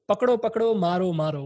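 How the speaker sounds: retold yell. Auto driver yelling to passers-by to grab the boy, mock yell. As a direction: shout it down the street, calling for help. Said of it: "literally yells".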